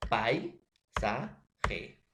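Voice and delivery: "Paisaje" drawn out slowly, syllable by syllable; syllabified demo. Shown as a demonstration. Teacher shows students how to divide "Paisaje", slowly. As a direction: split "Paisaje" into its syllables for the class. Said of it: "¿cómo voy a silabear?".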